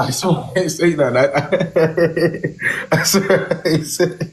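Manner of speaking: laughing through the words; cracking up mid-denial. Real, not acted. Denying it while cracking up.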